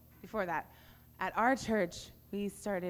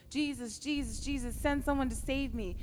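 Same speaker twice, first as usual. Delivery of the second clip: higher reenacted plea to Jesus, only a little louder; retold scream for help; 7 semitones higher, 4 dB louder than usual. Friend's prayer scream retold to the youth group, a mild mock plea. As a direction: recall the cry for rescue, half-performed. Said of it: "she started screaming".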